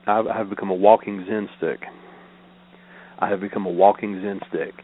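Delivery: ordinary delivery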